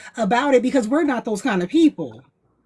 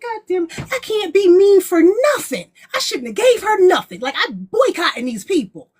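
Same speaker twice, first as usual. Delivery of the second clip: mock mad rant; 7 semitones higher than usual. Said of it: "You know, I'm mad".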